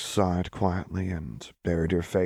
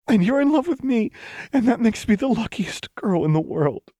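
cried out; 7 dB louder than usual. A narrator's reading. Lux declaring love to Jinx, crying out. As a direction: cry it out to the heavens. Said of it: "Lux cried".

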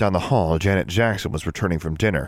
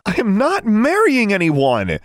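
slow, loud, high outburst; indignant shout, every word stretched; exclaiming; 6 dB louder, slower than usual. A narrator's reading. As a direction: refuse at the top of your voice. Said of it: "she exclaimed".

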